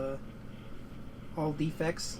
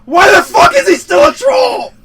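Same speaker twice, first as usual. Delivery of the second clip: builds from disbelief to a furious shout, much higher and louder; retold outraged yell; 19 dB louder than usual. Gamer reliving his rage at the troll Doomsday, outraged shout. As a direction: rant at the screen, exploding on the last question. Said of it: "I was like".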